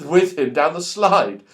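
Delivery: plain reading voice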